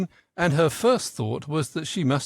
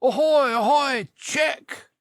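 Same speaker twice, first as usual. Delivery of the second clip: much higher, louder ahoy call; loud shouting; 12 semitones higher, 4 dB louder, slower than usual. A narrator's reading. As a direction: hail from afar at full voice. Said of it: "a loud shouting of".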